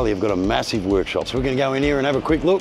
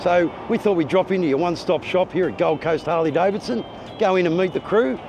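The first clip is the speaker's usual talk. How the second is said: upbeat presenter intro; 8 semitones higher than usual. Acted.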